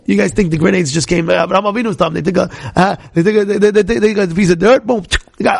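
ordinary talk, nothing marked

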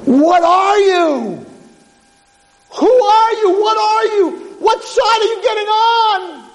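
high, loud urgent questions; frantic boy. Acted. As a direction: demand an answer, desperate. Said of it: "runs over to the angel and says".

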